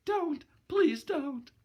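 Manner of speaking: put-on high, frightened pleading girl's voice; hunted cry. Gloria begging the boxer not to hurt her, high frightened plea. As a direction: plead shrilly as if cornered. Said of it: "She gave a kind of hunted cry".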